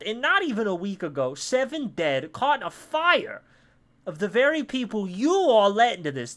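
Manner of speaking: put-on high, loud kid protester's tirade; ranting boy. Boy cemented to the road accuses the town, loud rant. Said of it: "he continues his, his, his rant".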